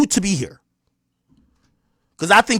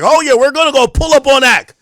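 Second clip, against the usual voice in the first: mocking bravado; 8 semitones higher, 6 dB louder than usual.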